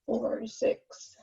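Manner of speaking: ordinary talk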